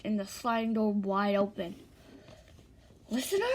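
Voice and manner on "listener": high, questioning call; searching, pitched-up query; worried calling. A narrator's reading. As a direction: call the name with a rising pitch. Ida searches for missing listener.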